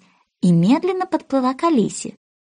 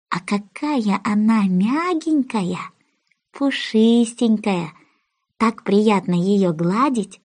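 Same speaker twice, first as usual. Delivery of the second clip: slow, doting description; slow, cooing fondness for the cat; fond cooing; slower than usual. Acted. Alice fondly describes cat.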